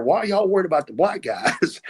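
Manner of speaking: high, baffled Southern question; incredulous. Retold or imitated. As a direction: ask it in total disbelief. Incredulous retelling of his confusion.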